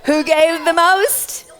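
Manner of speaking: high laughing refusal. Real, not acted. Teacher laughing at the McDonald's guess, high and laughing. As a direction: laugh through it, then ask again slowly. Child repeats McDonald's joke.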